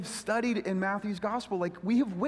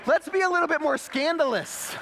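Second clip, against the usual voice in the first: high, loud defiant preaching; preaching at full force; 10 semitones higher, 4 dB louder than usual. Real, not acted. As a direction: preach it from the rooftops. Preaching peak.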